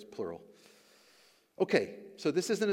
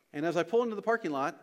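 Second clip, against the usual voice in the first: ordinary talk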